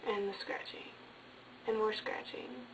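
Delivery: breathy, hushed spooky whisper; eerie whisper. A narrator's reading. Storyteller hushes the listeners with creepy scratching, whispered. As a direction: whisper it like a campfire ghost story.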